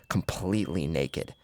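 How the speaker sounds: plain reading voice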